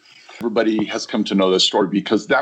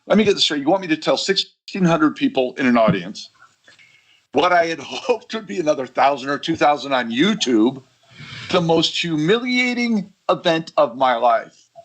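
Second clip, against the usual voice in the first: higher, incredulous sarcasm, spelling out the absurdity; sarcastic disbelief; 5 semitones higher than usual. Retold or imitated. Speaker retelling his protest to his team, sarcastic. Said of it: "they weren't sensing my sarcasm 'cause I said".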